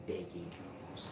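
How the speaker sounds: slow, soft whisper; drawn-out whispered put-down; hushed delivery. Shown as a demonstration. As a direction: whisper it slowly, sure they'll hear. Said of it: "Make sure you tell 'em like that too".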